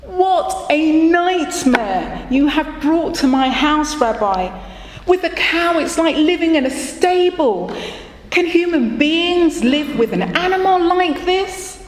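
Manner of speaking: high, wailing lament; a mild mock wail of a man at the end of his rope; poor man wailing to the rabbi about the cow. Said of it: "crying and wailing".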